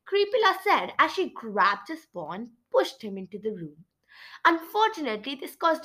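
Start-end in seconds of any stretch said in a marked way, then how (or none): none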